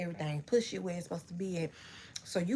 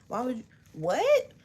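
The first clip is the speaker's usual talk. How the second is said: shocked; 6 semitones higher, 8 dB louder than usual. Retold or imitated. Retold shocked reply.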